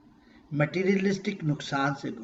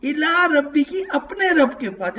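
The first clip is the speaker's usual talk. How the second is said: voicing God's tender invitation, pitched up; loving call; 7 semitones higher, 4 dB louder than usual. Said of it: "کتنے پیار سے کہہ رہے ہیں". (اللہ کی طرف سے پیار بھری پکار)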